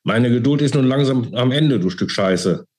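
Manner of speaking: restrained mock shout, dropping on the insult; angry shout. A narrator's reading. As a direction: snap with mounting rage. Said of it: "Mit wutverzerrter Miene schrie er sich an".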